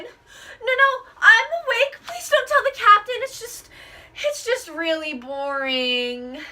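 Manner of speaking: high, whiny sailor begging the captain not to be told; high pleading whine, trailing into a sulky moan; pleading second mate. Acted. Second mate caught asleep, pleading.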